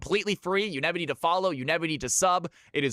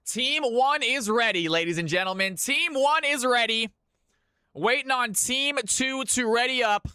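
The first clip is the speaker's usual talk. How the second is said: hype caster announcement; 6 semitones higher than usual. Acted. Caster announcing ready teams.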